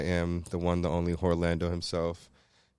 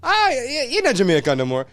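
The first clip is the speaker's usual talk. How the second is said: high, loud mock Jamaican accusation; mocking Jamaican critics; 13 semitones higher, 6 dB louder than usual. Host imitating Jamaicans disowning him, mock patois outrage. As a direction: imitate a scolding Jamaican, pitched way up. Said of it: "me tell people say".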